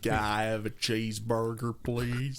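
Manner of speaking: put-on hammy English take on an American accent; overblown American accent. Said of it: "overblown American accents".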